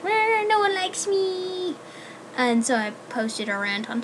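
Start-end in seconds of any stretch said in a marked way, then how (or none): 0.0-1.8 higher, slow whiny wail of self-pity; mock self-pity. Recalling her bad day, a slow, higher, hammed-up whine. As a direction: ham up a melodramatic sulk, stretched out. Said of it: "and I was just like".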